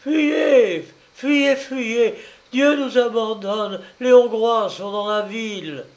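high, loud alarm cries; cries of distress. A narrator's reading. Women in the street warning the town is invaded, distressed cries. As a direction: cry out in distress to warn everyone. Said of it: "pousser des cris de détresse".

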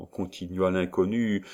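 usual speaking voice

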